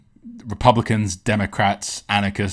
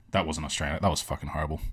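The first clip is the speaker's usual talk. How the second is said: usual speaking voice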